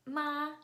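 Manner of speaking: Ma high, level and loud; first tone. Shown as a demonstration. Teacher repeating the tones for the audience, high level first tone on Ma. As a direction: hold Ma up high on one note. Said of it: "we have first tone".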